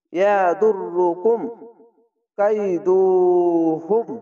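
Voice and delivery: slow ইয়াদুররুকুম কাইদুহুম, rising at the end; recited slowly. Shown as a demonstration. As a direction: recite the phrase unhurried. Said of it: "slow Quran recitation demo". (ধীরে তেলাওয়াত)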